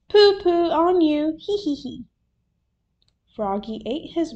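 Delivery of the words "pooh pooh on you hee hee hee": high, loud taunt, then giggle; teasing little one. A narrator's reading. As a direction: tease in a small child's singsong. Little Polly taunts Froggy.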